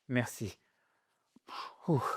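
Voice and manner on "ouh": usual speaking voice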